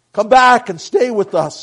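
loud, high call after someone; big urgent holler into the distance; shouting. A narrator's reading. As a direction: shout to bring someone back. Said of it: "he shouted".

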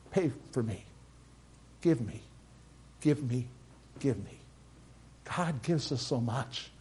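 usual speaking voice

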